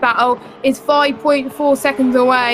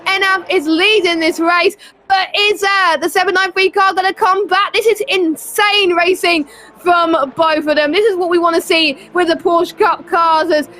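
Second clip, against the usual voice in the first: higher, building race call; excited commentary; 4 semitones higher than usual. Real, not acted. Commentator calling the lead change, raised and excited. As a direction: ride the thrill of the overtake.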